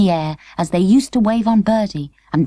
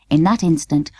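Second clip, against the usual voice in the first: usual speaking voice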